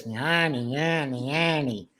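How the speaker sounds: mock Wisconsin twang on "yanny"; Wisconsin accent. Shown as a demonstration. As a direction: imitate Wisconsin speech with a repeated "yanny". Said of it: "mixing a Wisconsin accent into a New York".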